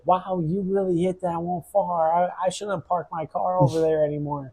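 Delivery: lightly voiced forty-year-old lady; quoting the lady. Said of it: "she's like".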